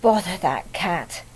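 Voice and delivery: low, grumbling Mrs Thomas; cross mother. Acted. Mrs Thomas finding the cat eating an egg, low grumble. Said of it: "Missus Thomas said".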